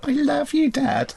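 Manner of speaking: high hammed-up child; sappy kid voice. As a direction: coo it like a syrupy little boy. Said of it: "would Randy go".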